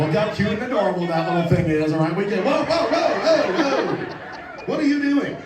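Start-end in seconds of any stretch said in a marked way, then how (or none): none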